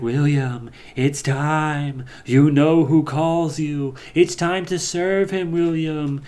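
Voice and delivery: slow, eerie summoning voice; creepy fish voice. Acted. Dead fish on the wall summoning William, slow and eerie. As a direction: draw it out like something calling from the dead.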